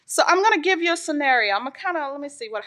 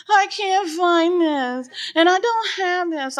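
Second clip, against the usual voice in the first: put-on higher, slow whiny child voice; lightly imitating a whining child; whiny kid; 5 semitones higher than usual. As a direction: whimper it like a kid who lost something. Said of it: "they come up to me going".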